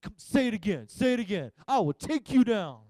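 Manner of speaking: imitating a daughter itching to fight; hammed-up fighting words; mock feisty threat. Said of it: "Jenny's like".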